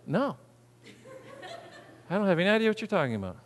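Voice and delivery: soft, fast, sheepish denial; mock denial. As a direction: brush it off quickly and quietly. Congregation's denial voiced.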